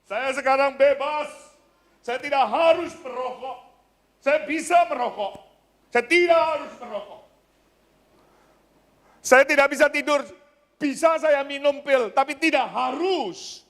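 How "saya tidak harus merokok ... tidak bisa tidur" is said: usual speaking voice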